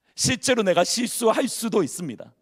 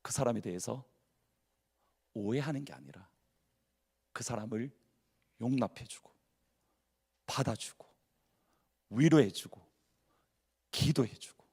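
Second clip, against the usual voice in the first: soft tender appeal; 8 semitones lower, 11 dB quieter than usual. Real, not acted. Tender appeal to forgive others.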